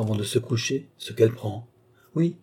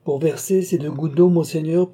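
higher and louder, rising on d'eau; sly mock innocence; 6 semitones higher, 4 dB louder than usual. Nicole answering the marshal with sly, raised innocence. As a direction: play it coy and knowing. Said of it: "repeated line".

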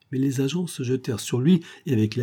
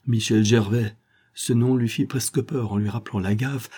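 usual speaking voice

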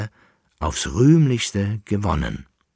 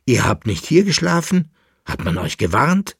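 high, loud anxious questioning; agitated call; 5 semitones higher, 4 dB louder than usual. A narrator's reading. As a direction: press for answers, pitch jumping up. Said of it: "rief er". (aufgeregt fragend, mit erhobener Stimme)

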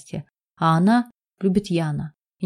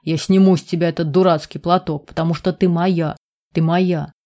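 plain reading voice

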